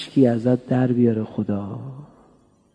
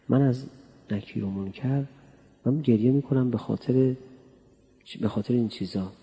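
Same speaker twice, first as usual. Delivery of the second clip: ordinary delivery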